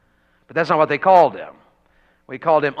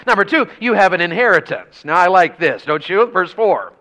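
ordinary talk

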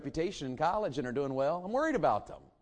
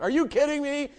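astonished; 12 semitones higher, 5 dB louder than usual. Retold or imitated. Retells his astonishment at the letter.

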